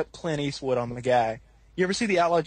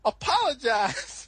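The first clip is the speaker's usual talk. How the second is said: slow, raised, drawn-out command; stretched-out order with laughter close behind; mock stern dad; 8 semitones higher, slower than usual. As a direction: imitate a dad laying down the law. Dad's order, retold.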